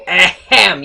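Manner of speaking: loud ahem; loud mock throat clear. Real, not acted. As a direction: clear the throat loudly for attention.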